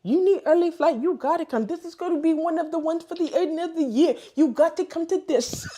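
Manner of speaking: put-on high, insistent Haitian-accented pleading; Dayana. Imitating a friend pushing her to take an early flight, Haitian accent. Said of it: "her Haitian accent".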